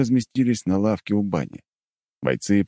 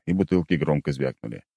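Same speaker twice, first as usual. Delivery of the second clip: ordinary talk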